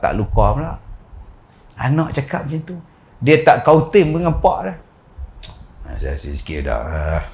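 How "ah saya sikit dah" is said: low, soft and slow little voice; small soft voice. Retold or imitated. Son answering his father in a small soft voice. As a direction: let the son's words come out small and timid. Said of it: "Dia buat suara halus".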